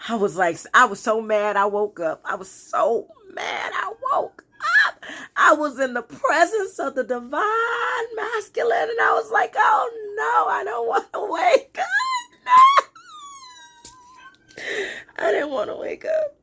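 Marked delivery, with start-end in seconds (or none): 2.2-5.0 hammed-up whine. Woman mad at waking from her dream, mock wail. 5.4-16.4 very high, whining mock cry; hammed-up wail. Woman reliving leaving the divine presence, mock wailing. Acts out dismay at waking.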